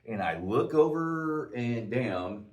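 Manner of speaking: ordinary talk, nothing marked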